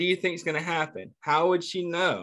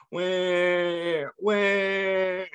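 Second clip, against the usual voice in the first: put-on a light, higher baby voice; baby's voice; 3 semitones higher than usual. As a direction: mildly voice the crying baby searching. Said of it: "So he was like".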